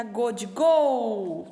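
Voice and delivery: "gol" drawn out on a long "go"; slow, stretched "go" as in "gol"; hard G demonstration. Shown as a demonstration. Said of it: "Se eu colocar o G, fica".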